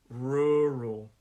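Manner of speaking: "Rural" drawn out slowly, both syllables stretched; slowed down. Shown as a demonstration. Teacher showing learners how to practise, slowed down. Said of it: "Slow your pronunciation when you practice this".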